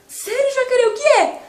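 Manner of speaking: high, eager question; curious and thrilled; eager prince. Acted.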